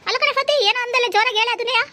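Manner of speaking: mother-in-law challenging the neighbour, high and loud; shrill mother-in-law. Acted. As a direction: pick a fight in a high, loud voice. Mother-in-law confronts Fatima.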